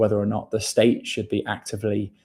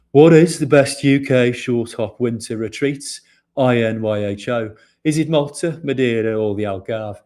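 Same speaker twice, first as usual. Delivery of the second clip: put-on Birmingham accent, loud and slow at the start; Arthur Shelby Brummy accent; 6 dB louder than usual. Host reads a listener's question in a Birmingham accent. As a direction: read a listener question in a heavy Birmingham accent, Peaky Blinders style. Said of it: "I'll read this in an Arthur Shelby accent".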